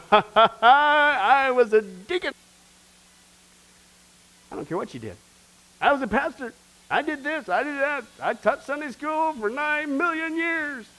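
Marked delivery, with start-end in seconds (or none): 0.1-2.3 louder, higher, slow proud brag with a laugh; mock a self-important deacon; boasting churchgoer. A churchgoer boasting before God, loud, slow and laughing. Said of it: "So where's the boasting?". 5.8-10.9 much higher, puffed-up bragging voice; boasting churchgoer. Retold or imitated. A pastor bragging of his service, high mock pride. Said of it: "So where's the boasting?".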